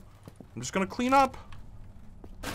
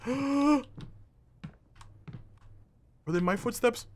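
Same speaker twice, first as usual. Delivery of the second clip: ordinary talk, nothing marked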